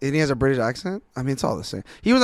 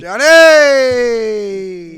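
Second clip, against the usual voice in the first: hype shout; 8 semitones higher, 12 dB louder than usual. Real, not acted. Hyping the bar's name.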